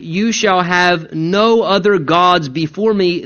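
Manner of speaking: "no" weighted, line said slowly; slow, lean on "no"; stress on no. Shown as a demonstration. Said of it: "The emphasis being on the word "no".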